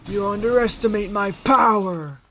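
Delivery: hammed-up defiant shout. Streamer quoting a film showdown, slow defiant shout. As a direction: bellow it dramatically, stretching the last word. Quoting a Star Wars villain's cry.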